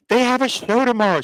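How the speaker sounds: high mock voice. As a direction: squeak it like a fussy stylist. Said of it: "they're like".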